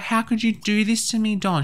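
high, hurt plea to Don; high wounded question. Acted. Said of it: "She's gonna be like".

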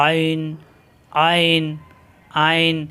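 আইন pressed from the middle of the throat; teacher modelling আইন with throat pressure; throat-pressed ain. Shown as a demonstration. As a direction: squeeze আইন out from the mid throat. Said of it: "কণ্ঠনালীর মাঝখানে চাপ দিয়ে উচ্চারণ করতে হবে".

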